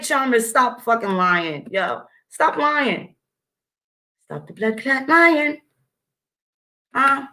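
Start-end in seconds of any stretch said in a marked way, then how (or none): none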